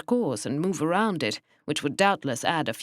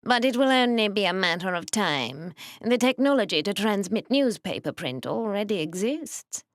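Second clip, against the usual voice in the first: whining nasal voice; 4 semitones higher than usual. Acted. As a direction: whine it through the nose. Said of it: "in his whining nasal voice".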